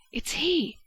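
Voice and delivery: high, sudden cry of recognition; startled exclamation. A narrator's reading. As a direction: blurt it out in shock. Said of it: "he exclaimed".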